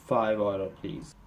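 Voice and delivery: low, soft, dropping away; imitating a condescending counter clerk. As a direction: talk down to them, low and quiet. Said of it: "says in the most condescending way".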